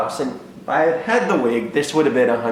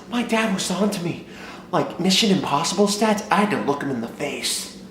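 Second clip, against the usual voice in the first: ordinary talk, nothing marked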